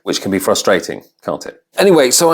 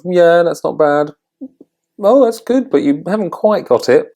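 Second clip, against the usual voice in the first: high, hedging voice of faint approval; guarded praise; 10 semitones higher than usual. Retold or imitated. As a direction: imitate someone reluctantly complimenting an attempt. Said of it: "quite guarded in their praise".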